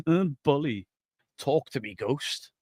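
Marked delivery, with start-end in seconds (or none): 1.4-2.5 hushed ghost-hunter voice. Retold or imitated.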